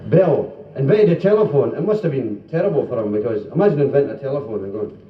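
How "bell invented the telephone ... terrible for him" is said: ordinary delivery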